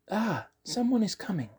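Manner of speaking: hushed little halfling listening; halfling girl voice. Acted. Halfling Virgil listening at door.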